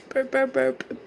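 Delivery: high, loud mock burps; silly burp noises. Real, not acted. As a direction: make goofy burp sounds.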